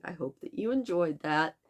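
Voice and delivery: ordinary delivery, nothing marked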